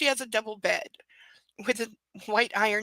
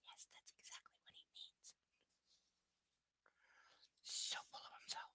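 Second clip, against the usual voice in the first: whispered eye-roll aside, much softer; sarcastic whisper; 23 dB quieter than usual. Real, not acted. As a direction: mutter it under your breath like a secret.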